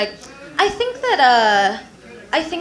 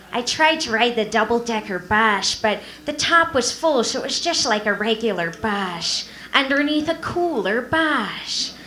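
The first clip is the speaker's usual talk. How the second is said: sad lateral-lisp Carol Channing voice; sigh, then lisp it out mournfully; slushy lisp. Pretend. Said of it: "she'd be all like".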